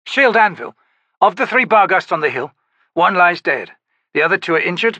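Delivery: a hint of a dutiful soldier reporting; soldier's report. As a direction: report casualties crisply to the commander.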